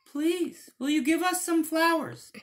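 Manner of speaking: very high squeaky wolf voice; little wolves. Acted. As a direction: squeak it like puzzled cubs. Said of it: "asked the little wolves".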